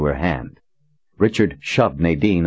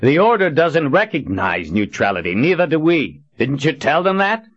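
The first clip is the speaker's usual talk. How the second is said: raised, rising angry voice; angry yell; 10 semitones higher than usual. Acted. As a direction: snap at her in anger. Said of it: "hadn't meant to yell at her".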